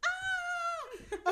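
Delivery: very high excited squeal; delighted high-pitched scream; fangirl squeal. Real, not acted. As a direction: let out a high, giddy "ah". Squeals over the kiss she recapped.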